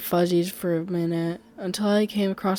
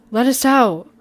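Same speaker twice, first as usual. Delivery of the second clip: loud, higher panicked cry; screamed plea; 4 semitones higher, 8 dB louder than usual. A narrator's reading. Trapped narrator screaming to be let out. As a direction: yell it at the locked door. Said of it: "I screamed".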